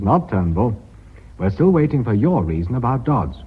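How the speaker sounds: ordinary talk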